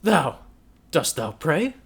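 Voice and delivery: loud, sneering cry; mock her prayer with contempt; loud, high contemptuous jeer; fiendish scorn. A narrator's reading. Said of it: "cried Giovanni, still with the same fiendish scorn".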